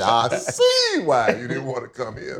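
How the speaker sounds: high, loud, drawn-out "see why"; comic exclamation. Real, not acted. Former player joking about a team nobody wanted, comic exclamation.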